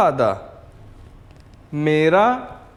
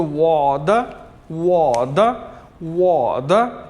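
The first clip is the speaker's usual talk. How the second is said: drawn out for students; slow model word; much slower than usual. Shown as a demonstration. Teacher models the word slowly for students. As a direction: stretch each syllable for learners. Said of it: "कैसे बोलेंगे?".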